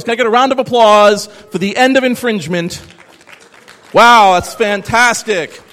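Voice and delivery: loud, high mock celebration; sarcastic hype. To the audience, cheering the end of infringement with sarcastic loud hype. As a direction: whoop it up sarcastically. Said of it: "pretty good half-hearted sarcastic clap".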